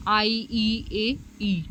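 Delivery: each vowel voiced on its own, drawn out for students; demonstrating vowels to the class; sounding out vowels. Shown as a demonstration. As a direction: model the vowel sounds one by one. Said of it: "उदाहरण के लिए".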